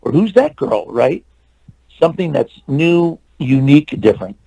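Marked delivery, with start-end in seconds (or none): 0.0-0.8 high-pitched curious questions; intrigued. Retold or imitated. People spotting fresh talent at a film, intrigued. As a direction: imitate someone taken with a newcomer.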